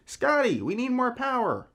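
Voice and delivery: high-pitched captain's call to the engine room; hammed-up plea to the engineer below; mock yell down. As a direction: call down urgently in a bad Star Trek voice. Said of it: "you yell down to the engine room".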